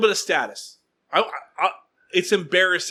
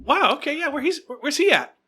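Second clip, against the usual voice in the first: eager mock excitement; 10 semitones higher than usual. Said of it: "I go".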